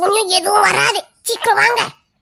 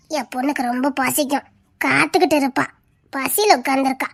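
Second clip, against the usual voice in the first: low, soft, sinister; creepy low murmur; 6 semitones lower, 6 dB quieter than usual. Acted. Ghost grandmother telling travellers her daughter hungers, softer and lower. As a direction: hush it like a secret threat.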